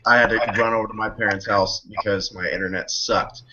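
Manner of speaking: normal talking voice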